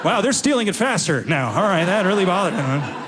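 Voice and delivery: ordinary talk, nothing marked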